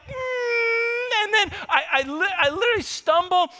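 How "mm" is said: high strangled groan of pain. Retold or imitated. Dad stubbing his foot in the dark, pained groan. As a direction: yelp as you hit your toe. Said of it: "and I'm like".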